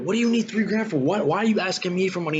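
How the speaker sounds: high, fast, mock-outraged questioning; indignant disbelief. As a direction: play dumb and offended at the request. Mocking his own stupid reply to dad.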